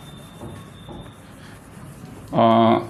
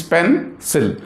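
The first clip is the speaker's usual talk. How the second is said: "pencil" broken in two, "pen", gap, "cil"; syllable by syllable; 2 semitones higher than usual. Shown as a demonstration. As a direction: pause between the syllables of "pencil". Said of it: "Here we have two syllables".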